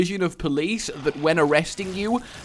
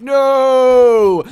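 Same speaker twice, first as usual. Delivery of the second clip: mock Luke scream; 9 semitones higher, 11 dB louder than usual. Acting falling Luke's scream.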